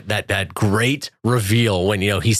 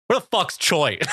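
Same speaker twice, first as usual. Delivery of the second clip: mock outraged demand; 7 semitones higher than usual. Acts out upset character's demand.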